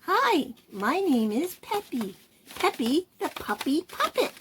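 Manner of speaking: high, soft little puppy voice; sweet puppy introducing itself; puppy puppet voice. Shown as a demonstration. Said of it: "make the voice different from their own".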